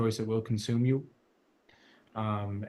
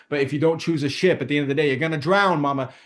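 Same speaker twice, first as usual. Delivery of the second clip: sassy warning; 4 semitones higher, 7 dB louder, faster than usual. Retold or imitated. Warning in posh voice continues.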